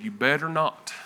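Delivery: low, soft fatherly warning; father figure voice. Retold or imitated. Still small voice warning him, quiet father figure. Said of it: "like a father figure voice".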